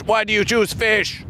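ordinary talk

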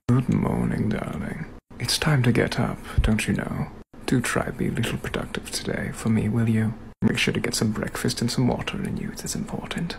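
gently firm British gentleman; British gentleman wake-up. Acted. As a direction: coax your darling out of bed as a proper gentleman. British gentleman wake-up role-play.

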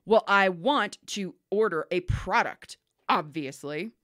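slow, emphatic mock-indignant caller; indignant, sarcastic. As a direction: imitate a cranky old lady, slowly and sarcastically. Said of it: "most indignant and sarcastic tone".

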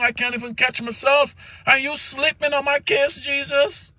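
high, strained preaching plea; pleading sermon. Acted. Preacher voicing a struggling believer, pleading. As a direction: cry out to Jesus like the one in trouble.

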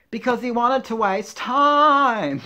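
loud, high, drawn-out punchline; big, slow, high-pitched joke payoff; hammed-up punchline. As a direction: belt out the corny punchline, stretching the end. Belts out a joke's punchline.

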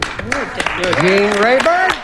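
long drawn-out rising call; game show host call. Real, not acted. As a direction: stretch Gene Rayburn out to the board. Host calls answer to board.

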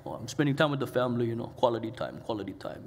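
a mild, mock-holy quick reply; pious mock reply. Preacher mocking his own pious excuse to a friend, lightly.

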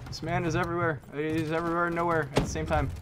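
ordinary delivery

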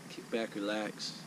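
slow, soft "relax", set apart for emphasis. Real, not acted. Angler settling in to set up camp and wait, emphasis on "relax". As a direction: lean unhurriedly on the word "relax". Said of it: "Emphasis on the relax".

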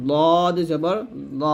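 দদ and দ thick, tongue side on upper molars; heavy D. Shown as a demonstration. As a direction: round out a thick দদ. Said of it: "দদটা মোটা করে উচ্চারণ করতে হবে". (মোটা করে দদ)

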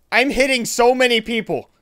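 exasperated yell; frustrated outburst. Real, not acted. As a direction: complain loudly in frustration.

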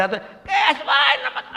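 high, slow, strained screech; screechy dry throat. Shown as a demonstration. Speaker mocks chanting with no water in the throat, screechy and strained. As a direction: drag it out in a scratchy, garbled high voice. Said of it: "వాడు ఏమన్నాడో తెలియదు గీచు గొంతు".

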